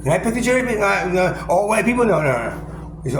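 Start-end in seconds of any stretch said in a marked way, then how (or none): none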